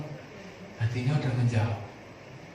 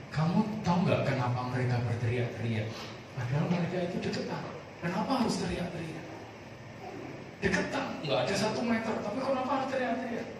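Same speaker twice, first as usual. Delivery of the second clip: a hint of a puzzled, soft old nun, a little higher; gently voiced Mother Teresa; 6 semitones higher, 4 dB quieter than usual. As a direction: ask it softly, wondering. Mother Teresa asks her pupils. (Mother Teresa bertanya dengan lembut dan heran)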